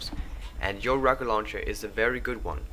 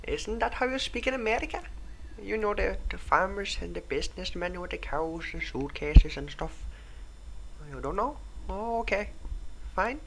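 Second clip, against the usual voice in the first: put-on Indian accent; 12 semitones higher than usual. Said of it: "Indian accent".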